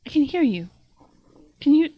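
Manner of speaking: high, loud little monkey voice; high-pitched creature calling out; talking monkey. Acted. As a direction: pipe up excitedly as a small monkey. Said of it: "The monkey".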